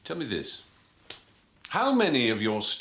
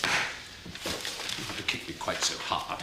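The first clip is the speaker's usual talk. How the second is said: pained whisper; 5 semitones lower, much slower than usual. Acted. Wincing complaint after kick.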